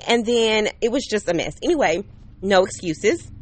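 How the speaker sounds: usual speaking voice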